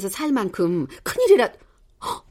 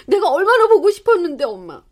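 higher and louder, overflowing with longing; emotional outburst; 7 semitones higher, 5 dB louder than usual. Acted. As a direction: let the years of missing her burst out. (북받친 감정)

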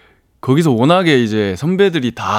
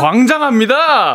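high, hammy exclamation; proclaimed punchline; 11 semitones higher than usual. Pretend. Guest landing an improvised acrostic pun, high hammy proclamation.

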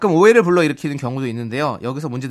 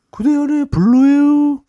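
라디오 DJ 곡 소개; 11 semitones higher, 6 dB louder, slower than usual. Acted. 곡 소개 외치기.

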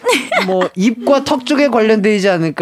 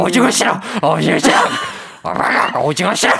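puppy voice; faster than usual. Shown as a demonstration. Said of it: "광아지와 하영 고양이의 대화".

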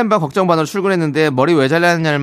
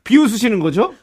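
high-pitched, aggrieved comic protest; hammed-up offended complaint; mock indignation; 8 semitones higher, 4 dB louder than usual. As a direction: challenge them as if deeply wronged. Comic quarrel over being mocked.

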